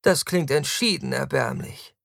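high-pitched, dismayed verdict; theatrical distaste; appalled disdain. A narrator's reading. Taktus appalled at domestic future.

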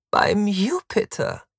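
high, loud, drawn-out exclamation; hammed-up appalled cry; theatrical horror. A narrator's reading. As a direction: recoil aghast, stretching the words. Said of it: "Taktus starrt ihn voller Entsetzen an".

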